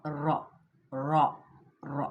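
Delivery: रॉ with one light tap of the र; correct ra. Shown as a demonstration. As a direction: tap र once in रॉ, no trill. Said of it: "ठीक है, इस तरीके से". (रॉ को हल्की तकरार से सही बोलना)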